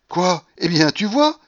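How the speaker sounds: high, loud gloating; exultant exclamation; triumphant. A narrator's reading. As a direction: crow that you were right all along. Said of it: "avec une indescriptible expression de triomphe".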